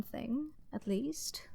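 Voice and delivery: soft whispered aside, slowed; sly whisper. Acted. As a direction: drop to a conspiratorial whisper. Sly aside about Salen.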